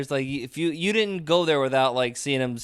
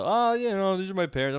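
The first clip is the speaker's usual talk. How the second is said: much higher-pitched mimicked young man; younger guy's voice; 7 semitones higher than usual. Young man explaining himself to the comic onstage, high mimicked voice. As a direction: imitate a sheepish young audience member, pitched up.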